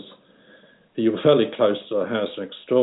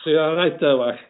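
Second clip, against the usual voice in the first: mock Scouse accent; 6 semitones higher than usual. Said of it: "another telling thing in Liverpool was the accent".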